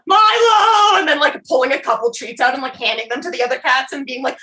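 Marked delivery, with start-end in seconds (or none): 0.1-1.0 high, loud wail; hammed-up hysterical cry for a lost cat; mock sobbing call. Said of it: "walking down the street sobbing".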